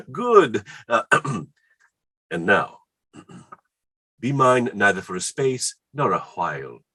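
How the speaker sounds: ordinary talk, nothing marked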